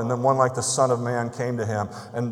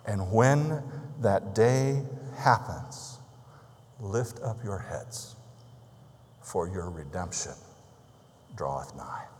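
very slow, soft, sinking to a whisper; solemn scripture reading; 3 semitones lower, 5 dB quieter, slower than usual. Acted. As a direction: let the promise settle, slowly and hushed. Solemn scripture close of sermon.

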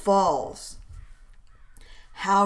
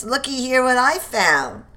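raised, eager call; excited find; 6 semitones higher, 4 dB louder than usual. Acted. Soldier calling comrades over, eager and raised. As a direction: show the men what you found.

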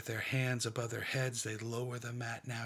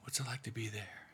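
hushed, half-whispered meditation, leaving long pauses; guided meditation. Acted. Leader guiding a prayerful meditation, in a hushed voice.

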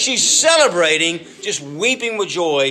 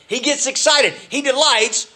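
lifted high with excitement; excited; 8 semitones higher than usual. Acted. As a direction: let the joy jump up in pitch. Preacher describing God's joy.

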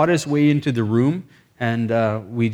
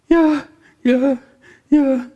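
very high, loud, strained replies; acted strained cry; 13 semitones higher, 6 dB louder, much slower than usual. Afflicted man asked to forgive, high strained cries. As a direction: imitate a tormented man crying out yes.

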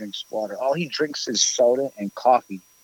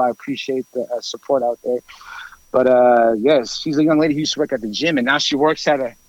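usual speaking voice

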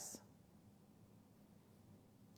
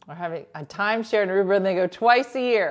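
loud, quick anchor read; punchy TV news delivery; 3 semitones higher, 11 dB louder, faster than usual. Pretend.

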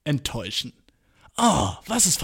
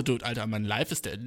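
younger self bragging; 7 semitones lower, 9 dB quieter than usual. Retold or imitated. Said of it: "früher war ich so".

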